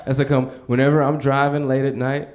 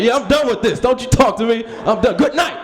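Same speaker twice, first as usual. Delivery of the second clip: high, loud petulant sign-off; pouty over-the-top good night; huffy sulking; 10 semitones higher, 4 dB louder than usual. Retold or imitated. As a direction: turn away in a mock huff.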